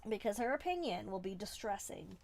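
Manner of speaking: usual speaking voice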